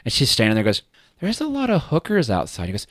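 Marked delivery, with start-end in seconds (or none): 1.2-2.7 high quoted voice. Retold or imitated.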